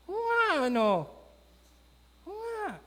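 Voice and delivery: high-pitched dawning "oh right"; sudden realization. Acted. Naaman suddenly realizing his servant is right, high surprise. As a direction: squeak it out as the penny drops.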